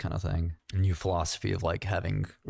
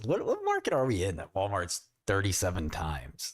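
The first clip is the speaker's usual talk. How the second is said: usual speaking voice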